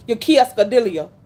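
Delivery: QUESADILLA mangled as kee-ess-kuh-DILL-ee-uh; mocked mispronunciation. Server mocking a customer's butchered word, mispronounced. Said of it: "that you can't even pronounce".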